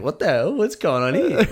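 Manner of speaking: high, laughing incredulous yelp; mock disbelief. Friend watching mate get hoisted up, amused high-pitched disbelief. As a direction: replay your bafflement, pitch climbing into a laugh. Acts his shock at mate lifted.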